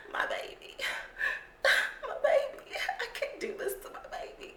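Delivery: high, soft fake crying; mock distraught. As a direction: wail weakly like a hammed-up distraught mom. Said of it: "She just looked distraught".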